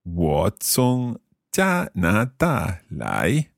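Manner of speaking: very slow, syllable by syllable; slow learner's repeat. Shown as a demonstration. Learner repeating the teacher's Chinese phrase, very slowly. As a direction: draw out each tone carefully. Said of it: "I'll try repeating them".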